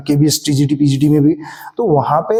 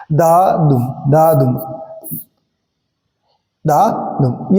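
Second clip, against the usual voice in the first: दा unstressed, दुम stressed; iambic stress demo; 2 semitones lower, much slower than usual. Shown as a demonstration. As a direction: beat out दा दुम with the weight on दुम. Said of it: "इस पे जोर पड़ रहा है". (दा दुम में दुम पर जोर)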